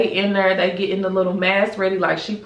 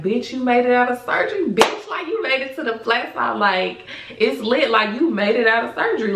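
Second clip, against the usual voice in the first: higher, buoyant self-cheer; giddy relief; 5 semitones higher than usual. Retold or imitated. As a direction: celebrate surviving, voice lifted. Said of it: "it just hit me like".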